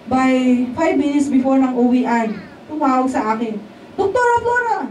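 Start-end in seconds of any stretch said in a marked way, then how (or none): 4.0-4.8 high bright call of a name, acted. Receptionist calling the doctor, high call. Receptionist calls her on phone.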